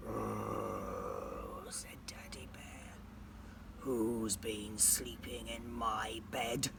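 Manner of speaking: very low slow hushed bear voice; low hushed papa bear; Daddy Bear. Acted. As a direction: rumble it quietly and slowly.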